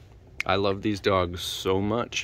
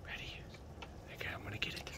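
whisper; 23 dB quieter, much faster than usual. Real, not acted. Whispered teasing with toy.

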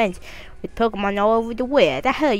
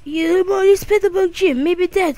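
high mom voice; 9 semitones higher than usual. Acted. Mom calling Kyrie to the TV, high and eager. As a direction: call him over like an eager mother. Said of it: "changing voices".

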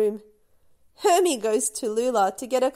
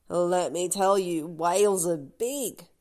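crab voice; 5 semitones lower than usual. Acted. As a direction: declare it like a know-it-all crab. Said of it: "Hermie declares".

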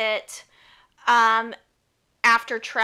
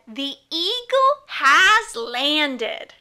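slow, solemn and louder announcement; astronaut radio call; 3 semitones higher, 6 dB louder, much slower than usual. Retold or imitated. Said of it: "they said".

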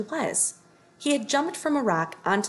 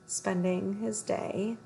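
usual speaking voice

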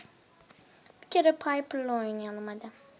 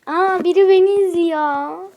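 surprised; 5 semitones higher, 10 dB louder than usual. Real, not acted. Notices someone watching.

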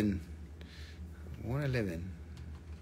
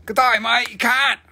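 loud, higher-pitched mock Australian; put-on Australian greeting, loud; Australian accent; 6 semitones higher, 6 dB louder than usual. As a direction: call it out like an Aussie. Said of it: "If I was Australian".

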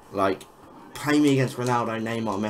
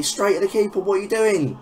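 exasperated outcry; 10 semitones higher, 4 dB louder than usual. Real, not acted. Frustrated at own player's miss.

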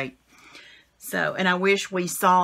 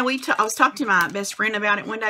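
ordinary delivery

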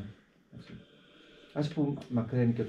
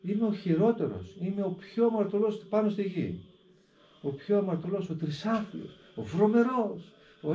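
raised pitch, louder, showy self-abasement; mock humble boast; 9 semitones higher, 4 dB louder than usual. Monk mocking those who boast of sinfulness, higher and louder. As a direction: overplay the false humility. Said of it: "πολλοί άνθρωποι που λένε".

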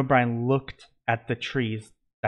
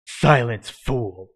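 growled; 5 dB louder, slower than usual. A narrator's reading. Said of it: "Hades growled".

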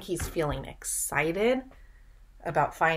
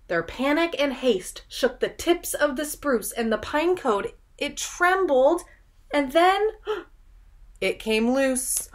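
higher, louder, breathless storytelling; rising suspense narration; 6 semitones higher, 4 dB louder than usual. A narrator's reading. Teacher reading the tumbling pine cone to children, tense. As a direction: build the tension to the gasp. Reads rhyming story aloud.

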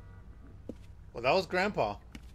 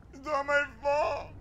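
put-on high, slow, mournful old-woman voice; Grandma's voice; 12 semitones higher, slower than usual. Streamer repeating Grandma's line in her high wailing voice. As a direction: wail it like a guilt-ridden grandma. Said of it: "That's the voice she uses".

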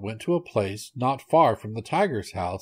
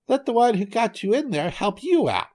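very high-pitched cartoon monkey; squeaky high retort; 11 semitones higher than usual. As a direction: fling it back in a high little monkey voice. Monkey refuses the trapped tiger.

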